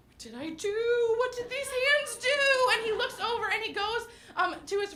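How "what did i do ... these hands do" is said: put-on higher, puzzled little boy voice; innocent toddler. Son asking what he did, higher innocent kid voice. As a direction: play it wide-eyed and innocent. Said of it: "my son looks and is like".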